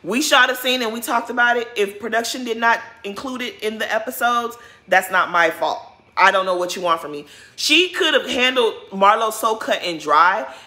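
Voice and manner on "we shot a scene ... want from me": fast, brisk brush-off; rapid dismissive reply. Retold or imitated. Voicing [name] brushing [name] off, fast and curt. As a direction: rattle it off to shut her down. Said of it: "Or just tell [name]".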